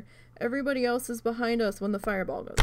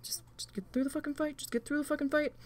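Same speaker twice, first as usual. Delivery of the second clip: muttered self-talk; 4 semitones higher, 6 dB quieter, faster than usual. Retold or imitated. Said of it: "I'm just like".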